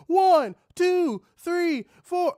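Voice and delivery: high, loud crowd chant counting along; chanting crowd counting punches. Retold or imitated. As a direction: shout the count like a whole arena.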